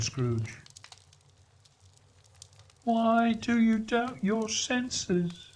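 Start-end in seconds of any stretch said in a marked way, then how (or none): 2.8-5.5 a mournful spirit laying claim; Marley's ghost. Acted. Marley questions Scrooge.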